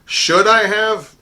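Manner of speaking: SHOULD I HAVE stretched out slow, high and loud; pointed rhetorical question. Real, not acted. Herald questioning his own court shtick, drawn-out emphatic question.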